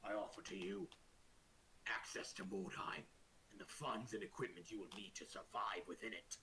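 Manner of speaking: low, measured royal voice; orc king. Acted. Orc king offering an adventurer access to Mordheim, grave.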